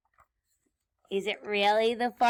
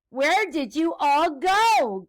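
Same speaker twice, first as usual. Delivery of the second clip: slow, loud demand, stretched out; witch's shout; 5 semitones higher, 9 dB louder, slower than usual. Acted. Witch demands answers from a duck, drawn-out shout. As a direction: holler it slowly, raised in pitch. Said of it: "Grinch shouted to a duck".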